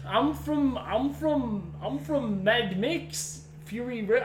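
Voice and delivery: high Australian attempt drifting South African; botched Australian accent. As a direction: keep retrying a bad Aussie accent. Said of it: "you sound like a South African".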